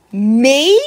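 loud, rising protest; high, indignant question; can't believe you're the one blamed; incredulous. Acted. Child told she should apologize.

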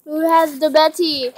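ordinary talk, nothing marked

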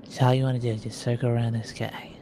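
whispering. Real, not acted. Instructing the team in a whisper.

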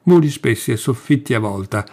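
ordinary delivery

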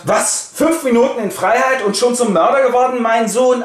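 a king berating his son in outrage; scolding father. Acted. (empörter Vater, hoch und laut)

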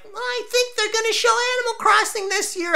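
squeaky hopeful fan voice; cutesy hopeful voice. Retold or imitated. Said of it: "some cute little video where I'm like".

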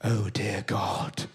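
hushed, low, breathy posh masseur gasp; posh masseur appalled by a client's hamstrings; posh whisper. Retold or imitated. Low whispered posh horror. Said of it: "honestly went".

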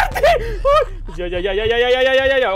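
high shriek melting into nervous laughing cries; panicked gamer laugh-crying; laughing scream. Real, not acted. Caught by the killer again.

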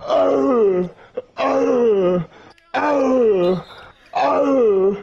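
high, strained imitated moans; imitating the noises she made; mock crying grunts. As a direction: mimic the noises through the wall.

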